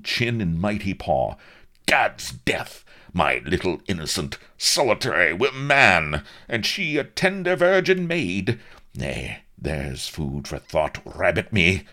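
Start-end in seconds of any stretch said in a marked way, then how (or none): 1.9-11.8 blustering, scandalised admiral, higher and louder; darkening suspicion. A narrator's reading. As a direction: brood aloud on your daughter's honour. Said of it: "His brow darkened ominously".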